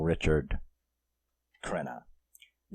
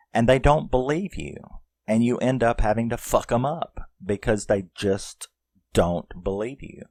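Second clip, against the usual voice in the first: normal talking voice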